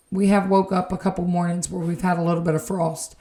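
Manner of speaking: usual speaking voice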